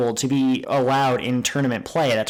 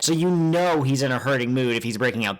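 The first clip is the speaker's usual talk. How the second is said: ordinary talk, nothing marked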